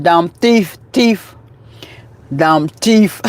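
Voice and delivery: "thief" said as "teef", with a hard T; imitating Red's pronunciation. Quoting Red's catchphrase to him, "thief" pronounced "teef". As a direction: mimic a friend saying "thief" with T for TH. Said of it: "How you say, um, Red".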